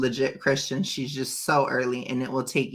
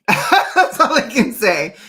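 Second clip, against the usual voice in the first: laughs through the words, high and loud; real laugh spilling over the line; 10 semitones higher, 10 dB louder than usual. Real, not acted. Laughing about her own pandemic.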